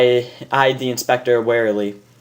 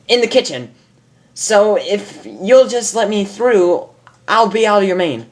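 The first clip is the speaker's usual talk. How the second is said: higher, hesitant, stalling voice; flustered Rainbow; 8 semitones higher than usual. Acted. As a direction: fumble for an excuse. Rainbow fumbling excuse.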